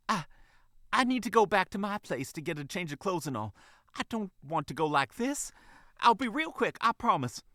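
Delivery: high, hurried, flustered mother voice; puffing, flustered. Acted. Fox mother promising her manager to hurry, flustered. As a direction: fluster through it in a high pitch. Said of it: "She puffed".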